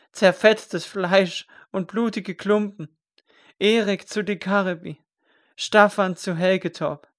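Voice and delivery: grief-stricken wail building to a plea; anguished wailing. Acted. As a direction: cry out the names of the dead. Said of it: "vor Weinen zu beben".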